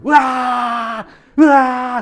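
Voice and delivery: high, loud, drawn-out "euaa" screams; mock screams of fright; imitated screams. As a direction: mimic distant victims screaming in terror. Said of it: "비명소리가 들려".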